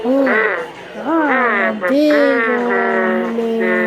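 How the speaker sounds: low, slow big man voice; big old man voice. Pretend. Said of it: "This is a man noise".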